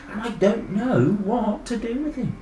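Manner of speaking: usual speaking voice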